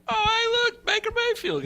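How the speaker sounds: very high, singsong discovery; mock surprise. Spotting Baker Mayfield on Twitter, very high and surprised. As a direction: squeal at the news.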